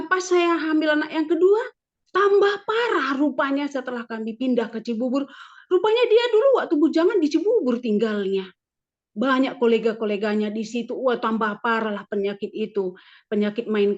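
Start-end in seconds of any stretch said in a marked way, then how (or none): none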